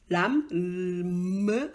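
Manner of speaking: 람 with a long hummed "me"; final M drawn out. Shown as a demonstration. Teacher showing the learner that it ends in a consonant, final M drawn out. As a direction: stretch 람 slowly into a held "me". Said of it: "ça finit par me".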